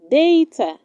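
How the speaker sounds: Data said day-ta; Data repeated as day-tuh; correct pronunciation. Shown as a demonstration. Teacher repeating the model word: Data as day-tuh. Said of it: "It is pronounced".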